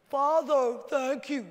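a polite thank-you with no excitement, said flat; stiff formal thanks. Retold or imitated. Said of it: "No, he goes".

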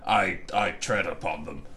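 lightly voiced disdainful bear of a man; haughty giant. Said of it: "he might have ... gone".

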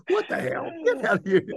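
incredulous. Retold or imitated. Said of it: "He's like".

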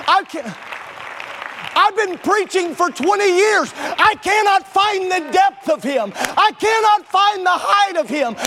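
sermon climax; preaching at full force. Real, not acted. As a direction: build it like a revival peak.

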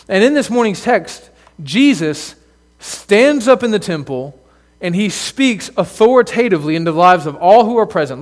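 ordinary talk, nothing marked

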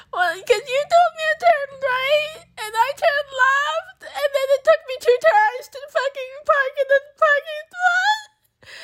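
very high, drawn-out wailed confession; mock blubbering. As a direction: squeal it all through exaggerated sobs. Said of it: "fucking blubbering in the car".

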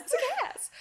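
squeaky high imitation of the line; high mock quote. Mimicking the film character in a high voice. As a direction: pitch it way up, playful.

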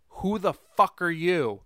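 loud slow shouted callout; audience callout. Retold or imitated. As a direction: yell it like the crowd. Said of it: "call and responses".

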